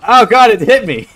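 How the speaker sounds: loud yelp as the shark bites; sudden loud cry of alarm; startled shout. Real, not acted. Shark attack in game.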